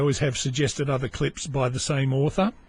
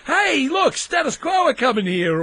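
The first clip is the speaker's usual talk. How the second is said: excited shout; 10 semitones higher, 3 dB louder than usual. Retold or imitated. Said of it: "they'd say".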